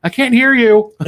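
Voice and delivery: mock technical-trouble yell; imitating calling out. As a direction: call it out loud and high, imitating a complaint. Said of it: "She pops on the exact moment".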